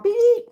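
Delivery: high loud beep noise; mock barcode beep; imitated scanner beep.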